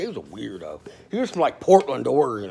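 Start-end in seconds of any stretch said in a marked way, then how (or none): 0.0-0.8 low, quiet scorn; scornful aside. Real, not acted. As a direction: mutter it with disdain.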